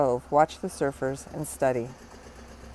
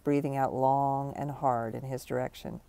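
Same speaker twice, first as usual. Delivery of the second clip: plain reading voice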